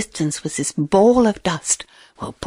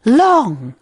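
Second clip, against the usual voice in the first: ordinary delivery, nothing marked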